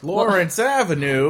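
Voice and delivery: high-pitched playful call; singsong recognition, pitched high; calling out the culprit. Acted. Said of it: "would say".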